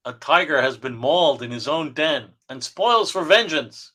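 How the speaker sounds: punchy, hammed-up announcer read; dramatic caption narration. Reading the jungle showdown captions aloud, melodramatic narrator voice. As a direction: read it like a pulp action teaser.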